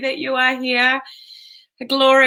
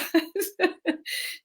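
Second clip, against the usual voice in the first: soft giggle; 10 semitones higher, 7 dB quieter than usual. Real, not acted. Laughs after swearing.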